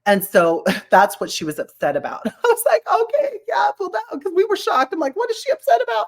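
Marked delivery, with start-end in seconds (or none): 2.3-6.1 laughing disbelief. Retold or imitated. Retelling shocked reaction laughing.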